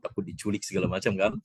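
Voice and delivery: ordinary delivery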